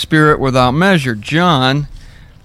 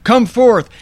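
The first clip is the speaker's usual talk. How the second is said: commanding call; 8 semitones higher than usual. Retold or imitated. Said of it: "That's how easy it is".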